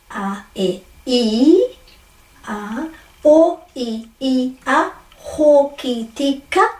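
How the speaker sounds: pure vowels singly, then hoki tika slowly by syllable; slow measured syllable drill; broken at vowels. Shown as a demonstration. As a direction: sound out each vowel, then ho-ki-ti-ka. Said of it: "breaking up at every vowel".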